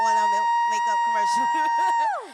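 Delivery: high, loud thrilled squeal of pride; excited squeal. Real, not acted. Guest squealing to the host about her commercial, thrilled. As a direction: squeal it out with joy.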